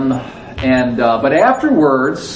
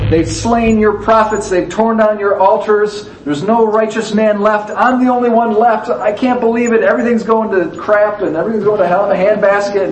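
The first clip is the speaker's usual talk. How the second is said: higher, whiny, frustrated prophet's lament; pouting complaint; 8 semitones higher than usual. Acted. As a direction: sulk out the grievances like a wronged child. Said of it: "all mad and he's frustrated and he's almost pouting".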